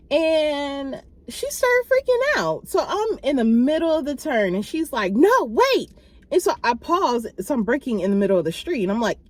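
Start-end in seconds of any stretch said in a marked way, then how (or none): 5.1-5.9 sharp high startled "wait"; mock panicked mother yelling; panicked cry. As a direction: imitate a panicking passenger. Mom panicking in passenger seat.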